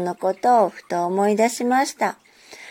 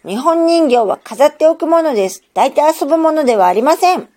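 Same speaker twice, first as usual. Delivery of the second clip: stern scolding; 6 semitones higher, 6 dB louder than usual. A narrator's reading. Aunt continues scolding.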